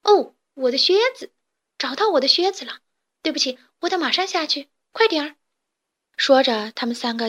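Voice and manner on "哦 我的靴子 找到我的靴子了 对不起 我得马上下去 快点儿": high, loud burst of joy; sudden excited cry. A narrator's reading. Chick spots its lost boots and cries out excitedly. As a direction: blurt it out, suddenly thrilled. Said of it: "忽然喊道".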